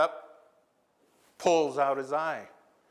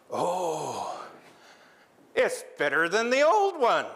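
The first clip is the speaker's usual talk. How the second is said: higher, louder, thrilled exclamation; delighted Raven; 4 semitones higher, 4 dB louder than usual. Acted. Raven tries his new eye outside, thrilled and delighted.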